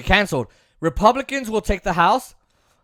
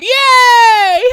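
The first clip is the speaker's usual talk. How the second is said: squeaky mock cheer with a laugh, much higher; 13 semitones higher, 7 dB louder than usual. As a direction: cheer sarcastically, then laugh. Mocking the squad's victory.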